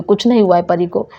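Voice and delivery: usual speaking voice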